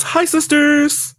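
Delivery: James Charles greeting. Retold or imitated. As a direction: push the greeting way up and stretch it. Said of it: "James Charles".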